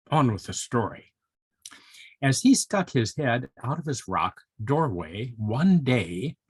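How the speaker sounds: ordinary delivery, nothing marked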